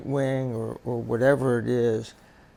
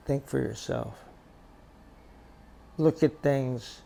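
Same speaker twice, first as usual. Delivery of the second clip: usual speaking voice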